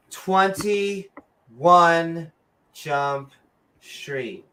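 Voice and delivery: usual speaking voice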